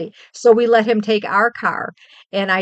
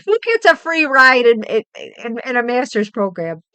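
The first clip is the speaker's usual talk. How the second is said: higher, louder, amazed at the deal; incredulous; 4 semitones higher, 5 dB louder than usual. Real, not acted. Mom to viewers about son's free ride, incredulous. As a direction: marvel at it out loud.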